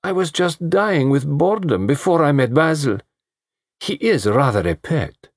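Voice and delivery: high, lazy bored socialite; bored vamp voice. A narrator's reading.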